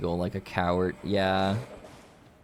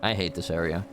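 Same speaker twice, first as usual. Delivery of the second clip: usual speaking voice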